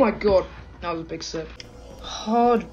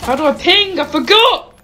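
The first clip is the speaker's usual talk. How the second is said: loud, much higher flustered cry; panicked yelp; 10 semitones higher, 9 dB louder than usual. Real, not acted. Player forgets the ping key mid-fight, high loud panic.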